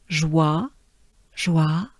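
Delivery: "joie" with a soft j gliding into wa; French teacher modelling "joie". Shown as a demonstration. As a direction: say "joie" slowly, soft zh then an open wa. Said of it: "soft j sound with a wa".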